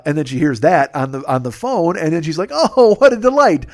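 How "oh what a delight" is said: high, loud delighted exclamation; imagined delight. Retold or imitated. Imagining her hearing the voicemail, mock delight. Acting out listener's delighted reaction.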